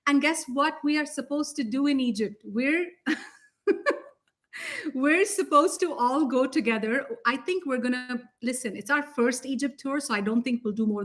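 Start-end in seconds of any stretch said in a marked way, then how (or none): 2.4-6.3 laughing through the words, slowed; speaking through a laugh. Real, not acted. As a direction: giggle while revealing the plan. Laughing while revealing plan.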